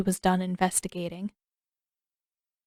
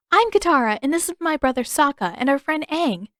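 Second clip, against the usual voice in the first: higher, bright eager greeting; eager introduction; 6 semitones higher, 5 dB louder than usual. Acted. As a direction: introduce everyone with enthusiasm. Katara eagerly introducing everyone.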